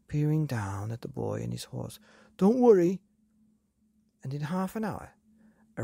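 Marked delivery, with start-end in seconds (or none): 2.4-3.0 loud, fast, high reassuring shout. A narrator's reading. The dog's owner shouts down to Billy in the quarry. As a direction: call down cheerfully to the boy. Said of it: "shouted the man".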